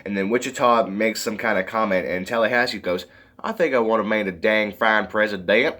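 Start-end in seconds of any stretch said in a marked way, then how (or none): 3.4-5.8 put-on terrible Southern accent. Tallahassee in the president's chair, bad Southern accent. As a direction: do a badly faked Southern accent. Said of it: "that was a terrible Southern accent".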